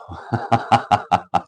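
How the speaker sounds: long staccato chuckle; laughing. Real, not acted. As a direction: break into a run of ha-ha laughs. Laughing after teasing a viewer.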